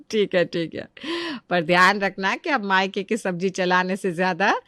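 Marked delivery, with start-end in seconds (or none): 0.0-0.9 softer, lower, chuckling through the words; laughing softly. Acted. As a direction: give in with a soft laugh. Mother-in-law laughs at song. (धीमी हँसी के साथ मान जाना)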